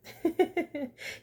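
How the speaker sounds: sudden high burst of laughter; real amused laugh. Real, not acted. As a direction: crack up at the absurdity. Laughing at Aiden's response.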